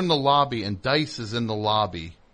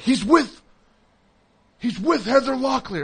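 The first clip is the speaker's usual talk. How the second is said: excited fan; 11 semitones higher, 4 dB louder than usual. Retold or imitated. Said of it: "They're like".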